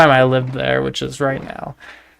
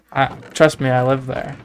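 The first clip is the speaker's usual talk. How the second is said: normal talking voice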